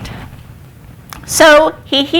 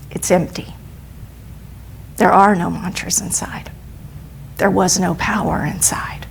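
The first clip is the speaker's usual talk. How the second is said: soft, unvoiced whisper of the twist; hushed reveal; 3 semitones lower, 7 dB quieter than usual. A narrator's reading. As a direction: drop to a secretive whisper for the reveal. Story reveal: amulet is empty.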